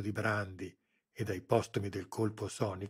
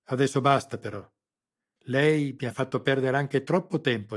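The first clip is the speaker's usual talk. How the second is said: loud, higher, fed up; impatient; 4 semitones higher, 9 dB louder than usual. A narrator's reading. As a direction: end the conversation sharply. Colonel loses patience.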